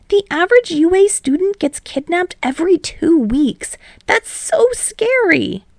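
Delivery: high, slowing timid voice; scared girl voice. Acted. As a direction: gasp it fearfully. Frightened student voice.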